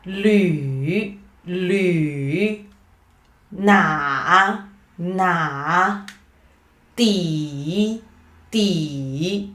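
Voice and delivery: "Lü", "na", "di" each dipping low, then rising; third-tone demonstration. Shown as a demonstration. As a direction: draw out each "Lü, na, di" in a falling-rising tone. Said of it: "focus on the third tone".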